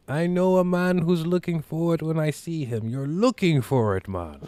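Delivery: high-pitched put-on voice of a street hustler; island dealer. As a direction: imitate an island plug making his pitch. Voices island dealer approaching him.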